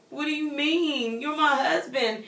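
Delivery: high, slow pleading with a laugh; half-laughing plea, as if about to cry; mock-hurt protest to her husband; near tears. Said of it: "I kinda almost wanted to cry".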